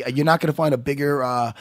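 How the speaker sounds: ordinary delivery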